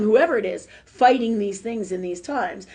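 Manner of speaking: normal talking voice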